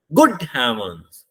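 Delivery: गुड said strong, voice drops through heavens; slow, punch गुड then fall; stress on गुड, falling tone. Shown as a demonstration. Teacher demonstrating the exclamation with a falling tone, stress on गुड. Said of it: "गुड को जोर से बोलते हैं".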